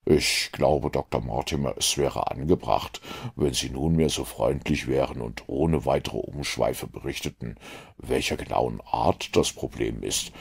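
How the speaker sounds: a dry detective mildly regretting his deduction. A narrator's reading. Holmes to Mortimer, low and rueful.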